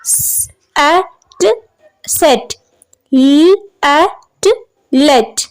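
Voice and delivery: "स-, एट, सेट" split slowly into first sound and rime, then blended. Shown as a demonstration. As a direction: stretch out "ल-, एट" slowly, then say "let". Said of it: "सेट और लेट के middle position में है".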